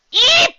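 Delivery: a sudden very high, loud squeak of fright; high shriek. A narrator's reading. Tracy running into the bear, shrieking eep. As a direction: squeak it out in a panic. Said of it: "she shrieked".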